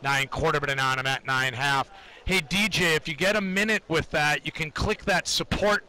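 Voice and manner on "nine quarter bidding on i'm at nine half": low, steady rhythmic price calls; trading floor price calls. Acted. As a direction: call the bids in a monotone chant. Live price ladder callouts.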